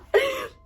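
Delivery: short high squealing giggle; bursting giggle. Real, not acted. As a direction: let out a quick delighted giggle. Laughs at own find.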